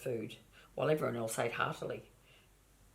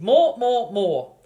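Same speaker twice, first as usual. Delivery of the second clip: loud, high, insistent more; greedy piglet yelling more; demanding shouts; 6 semitones higher, 12 dB louder, much slower than usual. Acted.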